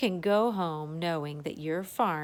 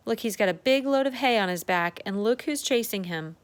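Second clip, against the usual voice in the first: usual speaking voice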